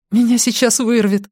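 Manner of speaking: high-pitched panicky outburst; about to be sick with panic; panicked. A narrator's reading. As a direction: voice shooting up in alarm. Parent in panic, feeling sick.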